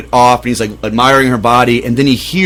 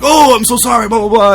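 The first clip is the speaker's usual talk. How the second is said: high, embarrassed babble; flustered apology; 9 semitones higher than usual. Retold or imitated. Brother caught admiring her, high and flustered. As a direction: squeak out a guilty sorry.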